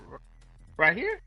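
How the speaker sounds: a dumb, eager high question; mock naive victim. Imitating the friend who falls for it, high and loud. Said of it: "He's like".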